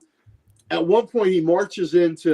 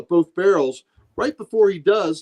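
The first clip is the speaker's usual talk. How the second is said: ordinary talk, nothing marked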